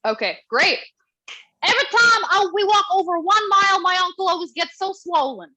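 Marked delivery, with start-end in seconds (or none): 1.6-5.5 put-on high, loud Romanian-accented dad quote; quoting her father in his Romanian accent; dad's Romanian accent. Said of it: "something my dad's told me".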